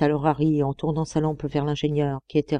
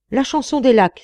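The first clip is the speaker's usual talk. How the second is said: higher, louder cry of recognition; exclaiming; 7 semitones higher, 5 dB louder than usual. A narrator's reading. Young miner recognizing a distant song, exclaiming. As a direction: call it out as you recognize the tune. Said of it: "s'écria Harry".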